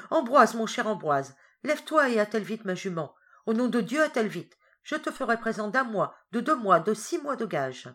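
crié. A narrator's reading. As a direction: shout for the servant in alarm. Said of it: "en lui criant". (appel pressant et crié)

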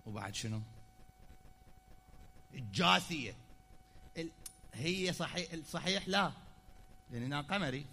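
usual speaking voice

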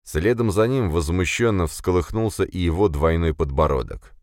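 usual speaking voice

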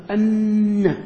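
أن said with a doubled, stressed nun; pronunciation demo. Shown as a demonstration. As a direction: model أن with the shadda held. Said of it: "كيف نقول ننطقها؟".

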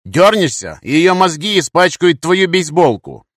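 hard, high-volume warning, drawn out; shouted threat. Acted. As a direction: threaten him forcefully at gunpoint. Threatening a man with hostage.

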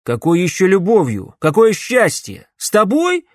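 loud, rising, incredulous shouting; outraged shout. A narrator's reading. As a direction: shout it in disbelief. Hero outraged by the witch.

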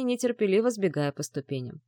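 plain reading voice

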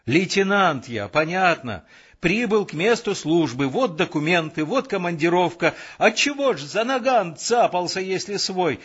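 high, agitated argument; heated arguing. A narrator's reading. Lieutenant and sentry quarrelling at the checkpoint, heated. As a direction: argue it hotly, pitch climbing.

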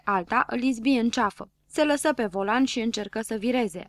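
ordinary delivery

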